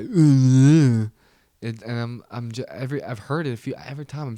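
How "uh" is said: long, loud subhuman grunt; mock men as inarticulate cavemen with a grunt; caveman grunt. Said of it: "it's like that".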